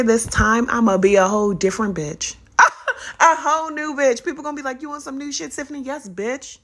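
usual speaking voice

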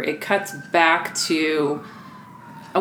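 usual speaking voice

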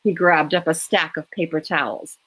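usual speaking voice

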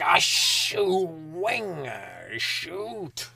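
mock dad catching himself mid-swear; almost swearing. Dad drops a cup, mock strangled near-swear. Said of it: "the only time my dad would allow himself to swear, or even almost swear".

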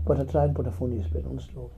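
normal talking voice

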